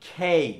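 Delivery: loud খেই with a strong kh; emphatic aspirated খেই. Shown as a demonstration. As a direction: answer খেই with a burst of air on the k. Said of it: "উচ্চারণটা হচ্ছে কি বলতো".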